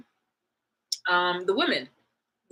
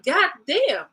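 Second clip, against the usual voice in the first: mock disbelief; 9 semitones higher, 4 dB louder than usual. Exclaimed reaction to messy men and women.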